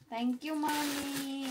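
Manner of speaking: put-on high, slow voice for the baby; mother voicing her baby's thanks. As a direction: speak for the baby, sweetly.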